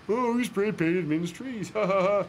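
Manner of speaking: slow, high mocking voice with a fake laugh; mocking pub pranksters. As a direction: ham up the snickering vandals. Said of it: "they laugh to themselves and think how funny".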